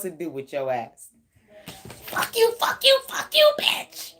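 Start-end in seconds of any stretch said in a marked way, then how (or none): 2.0-4.1 high squealing fake rage, building to a hiss; mock furious cursing. Frustrated ex cursing at reader, squealing fake rage. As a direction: shriek it out like a frustrated ex. Person at breaking point cursing.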